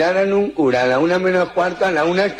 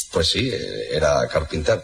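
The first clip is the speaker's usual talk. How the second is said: low, soft hesitant answer; uneasy Pope; 9 semitones lower, 3 dB quieter than usual. Acted. The Pope answering the Turk, low, soft and reluctant. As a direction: answer reluctantly, deep and quiet.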